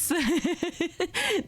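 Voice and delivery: a short bright giggle. Real, not acted. Laughing at herself after saying oops to viewers, a quick giggle. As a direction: laugh off the little slip. Laughing at her own slip.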